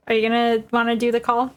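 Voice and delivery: normal talking voice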